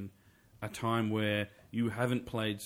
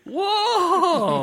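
laughing whoa; 11 dB louder than usual. Real, not acted. Reacts to bad impression.

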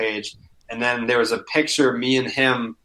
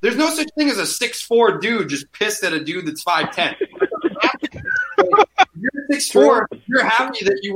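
mocking lecture; 7 semitones higher than usual. Retold lecture to heckler.

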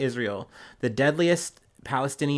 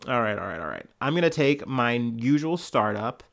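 ordinary talk

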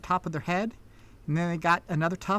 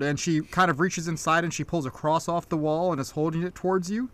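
ordinary talk

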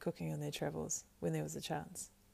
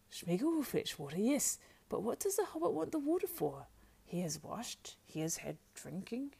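creature voice lifting into high questions; wheedling Gollum; 4 semitones higher than usual. A narrator's reading. Gollum questions Sam about the water, wheedling.

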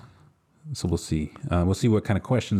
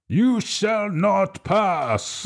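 booming Gandalf; 11 semitones higher, much slower than usual. Retold or imitated. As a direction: drag out each word like a wizard blocking a bridge. Quiz quote in Gandalf voice.

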